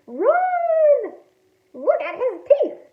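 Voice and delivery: high, loud shriek of alarm; screaming in panic. A narrator's reading. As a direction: warn the crowd in mock terror. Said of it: "people screamed and hid".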